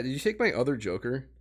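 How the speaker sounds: ordinary delivery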